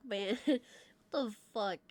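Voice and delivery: usual speaking voice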